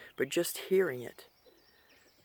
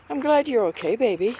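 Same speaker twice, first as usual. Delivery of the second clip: pet talk; 6 semitones higher, 7 dB louder than usual. Real, not acted. Greeting the pet emu.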